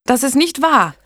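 high, loud indignant protest. A narrator's reading. Blanche defending her labouring friend against the midwife, indignant. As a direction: contradict sharply, pitch leaping up. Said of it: "widersprach Blanche entrüstet".

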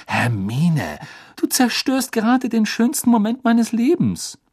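high-pitched, wailing complaint; aggrieved, pitch climbing high; exasperated protest. Acted. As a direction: protest as if the moment is ruined. Ron protests spoiled moment.

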